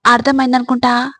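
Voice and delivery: ordinary talk, nothing marked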